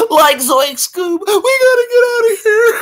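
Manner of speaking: very high, cracking cartoon panic; Shaggy. Urging his dog to flee, panicked cartoon squeak. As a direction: yelp in a squeaky scaredy-cat voice. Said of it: "there's Shaggy and Scooby".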